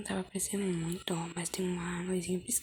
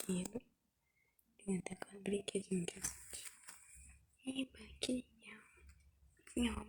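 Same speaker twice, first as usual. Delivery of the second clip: whispering; 5 dB quieter than usual. Real, not acted. Whispers through her doll play.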